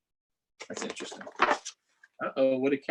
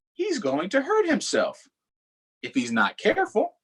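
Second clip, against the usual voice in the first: high, fretting sheep; worried sheep; 9 semitones higher than usual. Acted. Reading Sheep's thought to kids, high and worried. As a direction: worry for the reckless duck.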